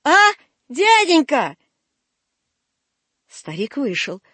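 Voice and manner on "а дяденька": slow, high coaxing call. Acted. Васька begs old man to return, calling out. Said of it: "крикнул Васька".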